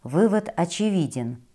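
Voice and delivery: ordinary delivery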